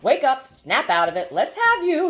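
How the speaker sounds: loud, urgent wake-up call; anxious calling. Acted. Iff waking the fallen Haroon, calling anxiously. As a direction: call out worried to rouse him. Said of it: "If anxiously calling".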